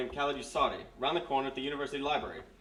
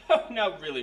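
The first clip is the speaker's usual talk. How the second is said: amused scoff; 5 semitones higher, 8 dB louder than usual. Acted. Major laughs at hypnosis claim.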